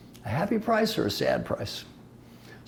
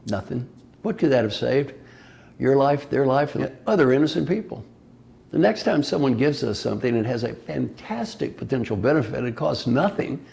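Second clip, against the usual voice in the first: usual speaking voice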